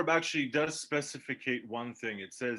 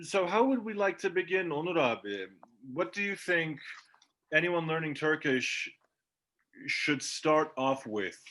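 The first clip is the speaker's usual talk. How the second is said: normal talking voice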